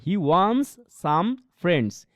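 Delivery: very slow demo of "He wants some friends", the t and d skipped; slow, careful pronunciation demo; dropped t and d. Shown as a demonstration. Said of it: "এখানে টি কে skip করতেছি".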